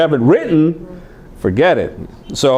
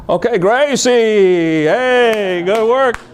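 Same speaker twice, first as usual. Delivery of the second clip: high, slow mock class chant; class imitation; 9 semitones higher, much slower than usual. Students echoing the teacher, in a high slow chant. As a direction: chant it back like bored students. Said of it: "Everybody's like".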